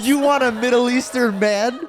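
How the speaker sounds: high, loud mock disbelief; hammed-up incredulous cry. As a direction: scoff it out at top volume. Retelling his scoffing, giggling reply.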